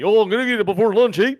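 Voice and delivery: high, hammed-up Canadian accent; mock Canadian accent. Co-host spoofs his own Canadian accent after a mix-up, mock Canadian. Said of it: "it's the Canadian accent in me".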